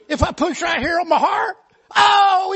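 high, tearful whine about pain; whimpering hypochondriac. Retold or imitated. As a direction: whine like every touch is agony. Arzy moaning in pain.

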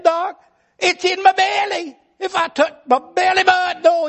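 high, tearful whine about pain; whimpering hypochondriac. Retold or imitated. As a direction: whine like every touch is agony. Arzy moaning in pain.

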